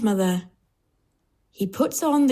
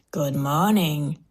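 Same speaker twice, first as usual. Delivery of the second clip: low, slow wolf greeting; wolf voice, drawn out and low; storybook wolf; 4 semitones lower, slower than usual. A narrator's reading. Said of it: "says the wolf".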